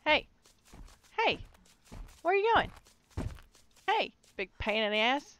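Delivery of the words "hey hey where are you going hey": taunting shout. Acted. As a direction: yell it up high as he walks off. Calling after the fleeing monster.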